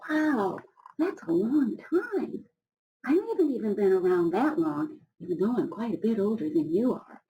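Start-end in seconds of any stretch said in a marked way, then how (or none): 0.1-2.4 high, slow wonder; amazed wow. Real, not acted. Reader marvelling at Old Rock's age for children.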